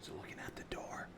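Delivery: unvoiced hushed whisper; just breathe the words. Real, not acted. Whispers at the table.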